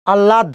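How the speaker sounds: আহ্লাদ said "al-lad", doubled l, no h; common pronunciation. Shown as a demonstration. Teacher asking students how they say it, common pronunciation. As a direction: run আহ্লাদ together as "allad". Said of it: "কি উচ্চারণ করেন বলেন".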